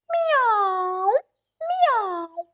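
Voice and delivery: long high imitated cat meows; two high, stretched-out meows. Cat corners trapped crow, long high meows.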